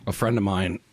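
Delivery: ordinary delivery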